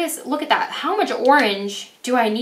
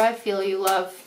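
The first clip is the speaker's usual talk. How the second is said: ordinary delivery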